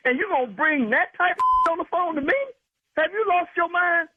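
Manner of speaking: furious scolding of the doctor; indignant rant. Real, not acted. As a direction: tear into him for disrespecting your wife. Husband outraged at prank doctor.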